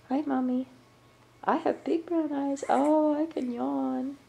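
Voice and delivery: high, lightly put-on baby voice; voicing the baby. Grandma speaks for the baby to the camera, high and sweet.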